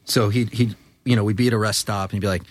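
ordinary talk

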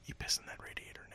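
soft unvoiced whisper of the line. Shown as a demonstration. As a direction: whisper the movie quote, fast. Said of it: "repeated as whisper".

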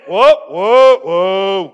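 loud calming-down whoas; mock alarmed wife. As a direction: call out to rein the driver in. Voicing alarmed wife in car.

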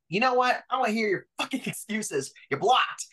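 shouting him down in the retelling; ballistic rant. Retold or imitated. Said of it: "I went ballistic".

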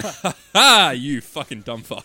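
loud mocking laugh, slow insult; gloating laugh. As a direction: laugh at the fool in the puddle.

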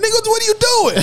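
can't believe he rolled it down; shocked high outburst. Retold or imitated. Telling friends how he snapped at his buddy, high and incredulous. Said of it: "I said".

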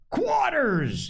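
captain roaring at his men to take their posts; roared order. A narrator's reading. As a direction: bark the order at the slackers. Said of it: "he roared".

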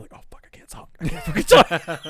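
breathless whispered groan; hurt, can't get the words out; whispering through pain after smashing a hand; strained whisper. Retold or imitated.

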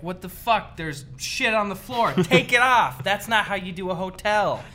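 loud, high mock Gordon Ramsay outburst; hammed-up chef outrage at a filthy room; Gordon Ramsay rant. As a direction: yell at the hotel staff like a furious celebrity chef. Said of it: "he'll be like".

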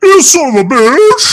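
high, slow, strained Chewbacca-like howl; Bigfoot voice. Shown as a demonstration. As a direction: howl it like a wookiee. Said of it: "Do you do one?".

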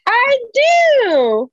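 high, loud delighted squeal; real excited squeal. Real, not acted. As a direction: squeal it out, thrilled to match. Twin tattoo discovered, squeal.

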